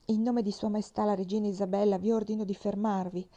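usual speaking voice

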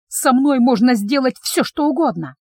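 ordinary delivery

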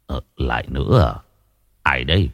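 a hint of a tired old man, low and soft; lightly voiced weary elder. A narrator's reading. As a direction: sigh it out like an old man used to bad news. Old Sách answers.

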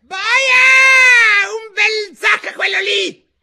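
piercing, very high screamed order; hammed-up queen's scream; shrieking queen. A narrator's reading. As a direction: shriek the execution order at the top of your range. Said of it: "e di strillare".

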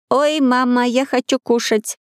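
high, slow toy-doll voice; talking doll. A narrator's reading. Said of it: "сказала кукла".

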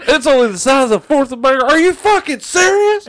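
high, fast outraged yelling; indignant customer shout. Retold or imitated. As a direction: rant like a cheated customer. Mocking angry customer.

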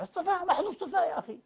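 a sarcastic brush-off of the claim; mocking scoff. Preacher scoffing at the rich man's claim of being chosen, mocking.

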